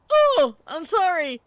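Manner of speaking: high, slow startled sorry; caught-off-guard oh, I'm sorry; flustered apology. Retold or imitated. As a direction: apologize in a rush of shock.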